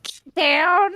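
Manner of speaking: high, loud yell with a country twang; imitated Kentucky holler. Wife mimicking her husband getting onto the cats, Kentucky holler. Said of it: "when he hollers, like, if he yells".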